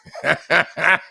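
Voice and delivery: showing how he laughed; demo laugh. Shown as a demonstration. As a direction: laugh it out the way he did then. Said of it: "I started laughing just like that".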